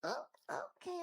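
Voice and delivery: ordinary talk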